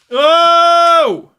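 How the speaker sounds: high, loud "oh" held out; loud startled cry. Real, not acted. Power loop goes wrong, high loud cry. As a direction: cry out at the impact. Another crash in flight.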